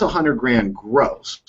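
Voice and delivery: a hundred grand gross drawn out slowly; slow emphasis. Shown as a demonstration. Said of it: "slowed for emphasis".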